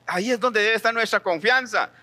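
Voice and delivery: ordinary talk, nothing marked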